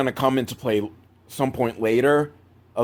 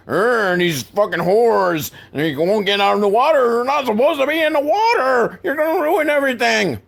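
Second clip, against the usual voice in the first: put-on high, strained old-man rant, building higher; crotchety old man; 9 semitones higher, 3 dB louder than usual. Old man upset at girls in the water, crotchety imitation. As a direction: rant like a cranky old man, rising to a wail. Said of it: "the crotchety old man".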